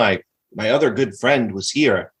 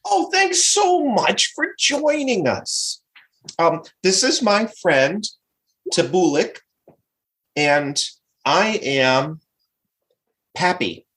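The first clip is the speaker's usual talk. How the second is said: friendly Pappy character; 6 semitones higher than usual. Acted. Adventurer introducing self and friend to the bard, friendly lifted voice. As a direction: introduce yourself as a sociable adventurer.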